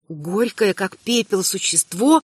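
usual speaking voice

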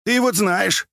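put-on high-pitched querulous old man; plaintive high old-man voice; whining old man. A narrator's reading. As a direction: complain in a high lamenting voice. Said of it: "продолжал ныть и причитать".

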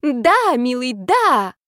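high, loud mock passion; faked ecstatic shouting. A narrator's reading. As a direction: shout pretend pleasure. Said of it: "заорала я".

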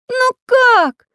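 very high, loud question; squeaky pencil character. A narrator's reading. Pencil wondering how to escape, squeaky. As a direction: squeak it out, at a loss.

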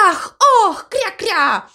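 high panicky gasps and quacks; hammed-up duck panic, high-pitched; frightened ducks. A narrator's reading. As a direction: squeal and quack like scared ducks. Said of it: "Утки смертельно перепугались".

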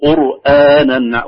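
"قرآنا" with a thin hamza, slow and careful; slow "قرآنا", keeping the hamza light; همزة مرققة. Shown as a demonstration. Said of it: "نقول".

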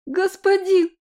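put-on high child's voice near tears; tearful child's voice. A narrator's reading. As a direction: whimper it like a scared little girl. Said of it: "срывающимся на плач детским голоском промямлило".

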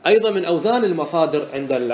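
normal talking voice

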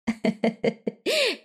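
high, soft ghostly titter; eerie ghost laugh. Acted. Ghost Hoa titters at Mận.

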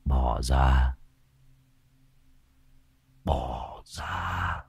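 low, eerie possessed voice, as if two voices mixed; low menacing growl. Acted. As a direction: growl it through the teeth like something else speaks through him. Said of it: "Sĩ gằn giọng".

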